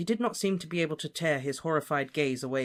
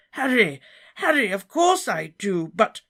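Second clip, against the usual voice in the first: much higher, louder flustered protest; flustered Slughorn; 8 semitones higher, 8 dB louder than usual. Acted. Slughorn protesting he wants Voldemort gone. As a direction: sputter in protest.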